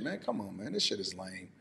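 quiet, fed-up grumble; muttered disgust. Real, not acted. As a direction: drop it under your breath, fed up. Disgusted dismissal after rant.

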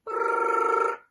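loud unvoiced buzzing brrr; loud buzzing alarm; alarm ringing. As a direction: rattle like an alarm clock. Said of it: "went the alarm clock".

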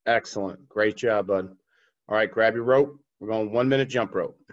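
ordinary delivery

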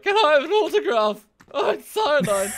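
high, loud starstruck fan. Pretend. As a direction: gush like an eager fan. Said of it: "I'd get an autograph and be like".

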